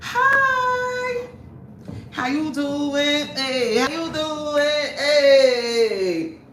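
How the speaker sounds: high, drawn-out squeal of welcome; excited greeting. Real, not acted. Streamer greeting viewers who just joined, excited squeal. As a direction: greet them in a long, high singsong.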